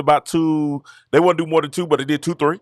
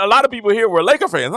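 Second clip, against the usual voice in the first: usual speaking voice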